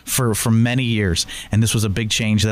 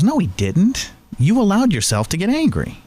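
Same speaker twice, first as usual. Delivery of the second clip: coworker correcting; 8 semitones higher than usual. Retold or imitated. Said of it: "He goes".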